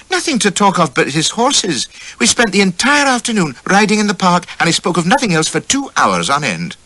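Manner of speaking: put-on high, lightly scornful young lady's voice; amused wife. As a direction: dismiss a dull admirer, pitched high. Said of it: "says she, laughing".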